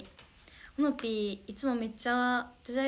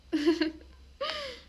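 bright burst of high laughter; high giggle; 11 semitones higher than usual. Real, not acted. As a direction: laugh it off after stumbling over a word. Laughs after fumbling a word.

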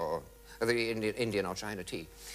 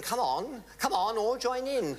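cheerful urging; 11 semitones higher, 3 dB louder than usual. Retold or imitated. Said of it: "she was saying".

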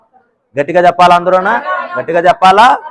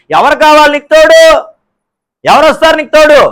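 higher, louder declaiming sermon; forceful preaching; 6 semitones higher, 5 dB louder than usual. Acted. Preacher challenging congregation about companionship, forceful preaching. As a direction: hammer the truth home to the crowd.

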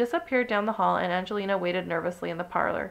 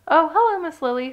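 high, loud, chirpy hello; sing-out telephone hello; phone greeting; 8 semitones higher, 8 dB louder than usual. A narrator's reading. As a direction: greet a caller with pitch way up. Answering the telephone in story.

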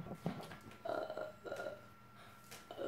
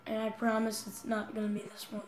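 normal talking voice

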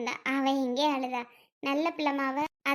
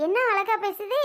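higher, fond laughing praise; delighted laugh; 6 semitones higher than usual. Acted. Grandmother praising the little girl's sweet talk, laughing fondly. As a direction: laugh through the words, pitched up.

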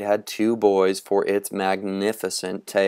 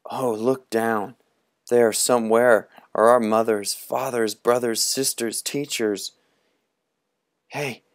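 thinking aloud; 3 semitones higher than usual. Acted. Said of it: "and thought".

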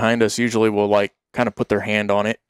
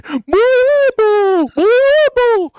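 very high, louder hammed-up wailing boos; mock bawling; 5 dB louder, much slower than usual. Friend rolling on the ground, hammed-up crying boos. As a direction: wail like an overgrown baby. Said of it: "rolling around screaming".

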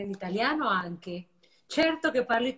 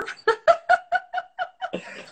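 high laugh; 6 dB louder than usual. Real, not acted. Laughing after blowing kisses to the audience. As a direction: burst of high-pitched laughter.

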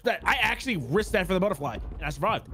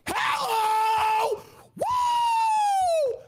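gleeful whoop; much slower than usual. Real, not acted. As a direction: whoop with joy while running. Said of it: "Gotta go fast".

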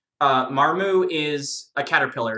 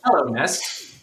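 a lightly voiced Hello Nest, odd timbre; host playing the king's court greeting; mock formal greeting. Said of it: "the official greeting".

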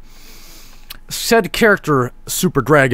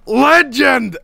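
excited cheer; 10 semitones higher, much slower than usual. Real, not acted. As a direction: hail him like a hero. Cheering the wrestler.

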